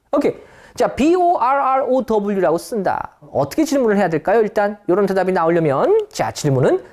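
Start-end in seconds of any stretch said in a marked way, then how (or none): none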